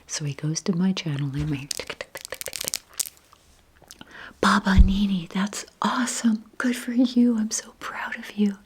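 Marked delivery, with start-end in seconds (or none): 1.5-2.8 soft tongue-tick typing noises; imitated phone clicking. Grandson looks up the channel, imitated clicking. 4.4-8.6 proud, excited grandson. Retold or imitated. Grandson congratulates grandma on her channel, proud excitement.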